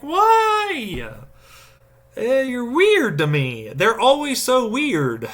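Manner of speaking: high, slow whiny complaint; a high, drawn-out mocking whine. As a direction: whine it like a puzzled child. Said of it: "And I'm like".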